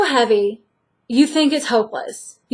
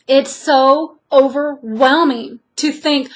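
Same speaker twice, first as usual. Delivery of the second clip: usual speaking voice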